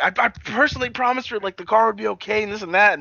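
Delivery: earnest promise. Retold or imitated. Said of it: "Chuck was all like".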